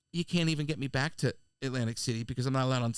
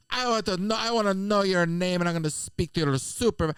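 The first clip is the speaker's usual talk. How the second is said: higher-pitched imitated Jamaican complaint; berating Jamaican accent; 3 semitones higher, 3 dB louder than usual. Passenger demanding the attendant's name, berating in imitated Jamaican accent. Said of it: "she's berating this girl".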